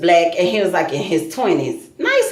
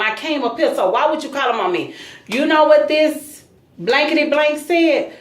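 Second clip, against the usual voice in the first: ordinary talk, nothing marked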